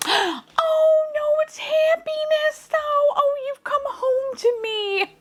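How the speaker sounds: very high, slow adoring wail; high-pitched joyful cry; overcome with delight. Real, not acted. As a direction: squeal it high, stretching the words. Unexpectedly pulls the wished-for toy.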